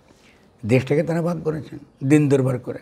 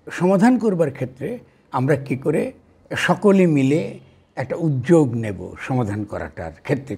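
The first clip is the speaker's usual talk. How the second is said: ordinary delivery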